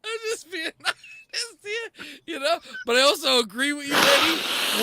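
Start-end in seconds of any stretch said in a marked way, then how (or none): 0.0-2.1 high, slow, laugh-crying; words squeezed out through laughter. Real, not acted. Host losing it while trying to be sincere, laughing. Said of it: "I'm crying".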